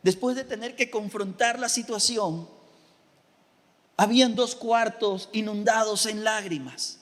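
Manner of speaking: normal talking voice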